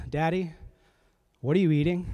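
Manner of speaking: a hint of a small child, curious; lightly voiced little girl calling down the stairs; lightly voiced five-year-old asking her daddy; child's voice. Daughter catches dad snacking at night.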